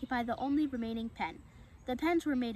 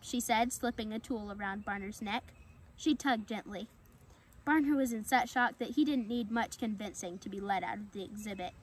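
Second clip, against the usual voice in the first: plain reading voice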